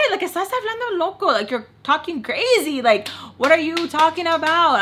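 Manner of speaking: high-pitched exasperated comeback; incredulous retort. Retold or imitated. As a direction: act out disbelief in an argument, pitch up. Acted argument, incredulous retort to him.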